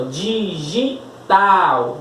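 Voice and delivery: "Digital" very slow, as DI, GI, TAL; three separate sounds. Shown as a demonstration. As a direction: count out the three syllables of "Digital". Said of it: "São aí três sons". ("Digital" em três sons separados, bem devagar)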